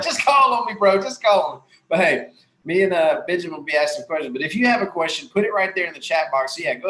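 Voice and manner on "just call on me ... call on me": high, loud mock eager begging; teasing a friend's keenness, mock eager pleading; eager plea. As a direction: play the keen guy itching to be called on. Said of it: "he's like".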